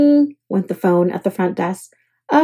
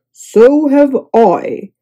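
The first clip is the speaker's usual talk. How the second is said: slow, louder grumble; grumbled; 2 semitones higher, 5 dB louder, much slower than usual. A narrator's reading. Tired guest to bellhop, grumbling slowly. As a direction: drag it out, grumbling. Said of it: "grumbled Mister Snore".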